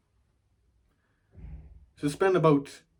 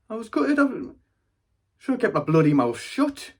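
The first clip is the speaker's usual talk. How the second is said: raised-voice mock indignation; indignant regret; 10 semitones higher, 5 dB louder than usual. Grandchild gutted at the room laughing, raised indignant voice. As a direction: protest it high, as the laughed-at kid. Acting out childhood humiliation at being laughed at.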